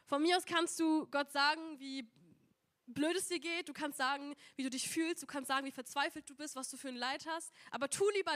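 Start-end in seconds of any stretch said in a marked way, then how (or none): none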